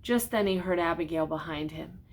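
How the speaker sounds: put-on gentle read-aloud to a child; storybook reading. A narrator's reading. Reads picture book aloud.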